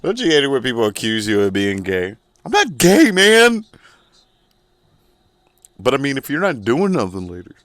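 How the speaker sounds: high, loud put-on voice protesting too much; hammed-up macho guy. Acting a closeted guy.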